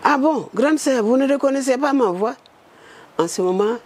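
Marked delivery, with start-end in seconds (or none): 0.0-2.3 teasing caller. Retold or imitated. Caller teasing her on phone.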